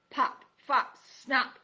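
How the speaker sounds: loud, irritated call; calling the mouse's name crossly; cried out. Acted. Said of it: "Aerith cried".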